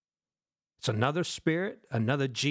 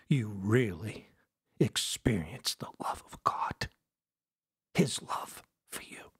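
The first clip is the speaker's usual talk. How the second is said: tender, hushed appeal; 8 semitones lower than usual. Real, not acted. Preacher asking about God's love for you, hushed and tender. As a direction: let it drop almost to a whisper.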